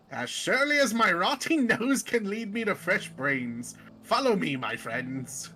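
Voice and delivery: put-on genteel undead emissary with British accent. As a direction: recount it grandly in a British accent. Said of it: "I gave him a British accent".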